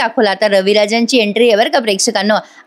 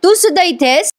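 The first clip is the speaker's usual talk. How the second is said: high, indignant question; the father, furious, spots his son among them; angry surprise; 8 semitones higher than usual. Retold or imitated. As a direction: spit it out, shocked and angry. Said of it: "अश्विनला बघून चिडतात".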